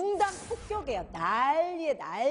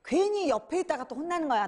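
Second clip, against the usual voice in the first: usual speaking voice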